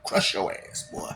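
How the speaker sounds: soft whispered menace; whispered threat. Acted. Whispering a playful threat at the viewer. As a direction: breathe the threat out.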